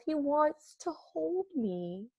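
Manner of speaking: soft, high, slow and dreamy; a sweet dreamy whisper-coo; tender. Acted. Arnie's tender thought.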